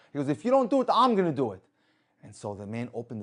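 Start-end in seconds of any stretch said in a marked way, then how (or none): 0.3-1.6 loud, raised-pitch ultimatum; retold forceful insistence; insistent ultimatum. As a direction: push back hard at the reluctant passenger. Driver threatens to do it himself.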